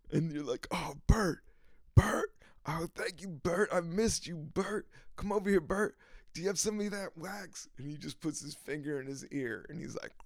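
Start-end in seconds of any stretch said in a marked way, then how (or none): 0.7-7.7 higher, needy pleading to Bert. Retold or imitated. Pleading to Bert for lip wax, higher and needy. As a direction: beg him like a long-lost friend. Begging Burt for lip balm.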